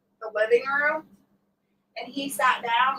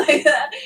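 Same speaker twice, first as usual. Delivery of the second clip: sharp loud mock gasp; acted gasp; 4 semitones higher, 7 dB louder than usual. Retelling to viewers how she spun around, loud mock gasp. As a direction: show the gasp you gave. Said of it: "I turned around and I was like".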